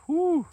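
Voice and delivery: high, loud whew of exhaustion; worn-out whooping exhale; tired whew. Real, not acted. Tired man blows out breath.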